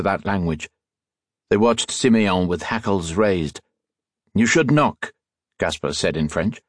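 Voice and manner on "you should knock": higher-pitched, irritated master; mildly haughty rebuke; scolding. A narrator's reading. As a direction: reprimand a servant. Master scolds his servant.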